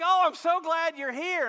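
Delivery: high, loud, gushing dog; happy dog greeting you at the door; excited dog voice. Retold or imitated. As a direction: gush like an overjoyed dog. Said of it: "Dogs are like".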